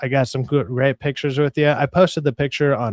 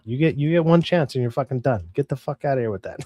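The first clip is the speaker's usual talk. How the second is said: ordinary talk, nothing marked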